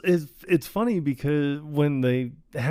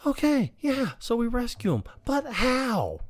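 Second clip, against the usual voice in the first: high-pitched, skeptical character voice; mock-squeaky objection; doubtful sidekick; 12 semitones higher than usual. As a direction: imitate a worried sidekick questioning a plan. Acted sidekick doubting rescue plan.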